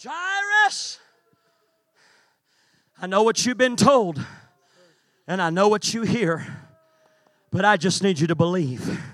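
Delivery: soft, low and tender; gentle voice. Acted. Jesus gently reassuring grieving Jairus, soft and low. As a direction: draw the congregation in close and speak gently.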